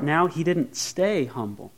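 ordinary talk, nothing marked

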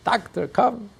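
high, refined call for the doctor; mock elegant yell. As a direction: imitate a woman calling out genteelly. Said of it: "yells out in a beautiful, eloquent French".

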